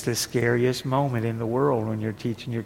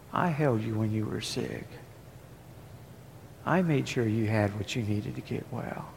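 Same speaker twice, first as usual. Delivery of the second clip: hushed, softer first-person voice of God; God speaking softly; 6 dB quieter than usual. Acted. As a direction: speak as God, quietly and close. Preacher voicing God softly.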